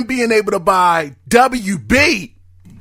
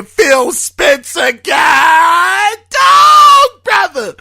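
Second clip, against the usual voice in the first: real overjoyed yell, much higher; ecstatic shout; 7 semitones higher, 5 dB louder, much slower than usual. Real, not acted. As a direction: voice leaps much higher and louder into a near-scream. Host overwhelmed by the acquisition news.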